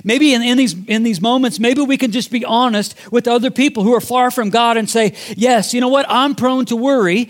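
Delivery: rising sermon appeal; impassioned preaching. Real, not acted. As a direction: preach it out to the congregation.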